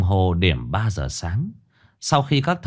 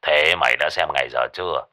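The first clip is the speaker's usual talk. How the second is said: put-on lower gruff old man; 7 semitones lower than usual. A narrator's reading. Lão Năm trên điện thoại.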